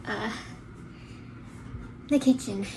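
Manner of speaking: winded after cleaning; out of breath. Real, not acted. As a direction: talk while catching your breath. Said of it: "I feel like I just ran a marathon".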